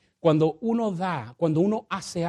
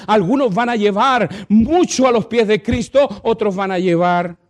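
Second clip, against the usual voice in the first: loud, driving preaching peak; preaching climax; 5 semitones higher, 10 dB louder than usual. Acted. Pastor quoting Paul to the church, loud. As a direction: build to a loud cry to the church.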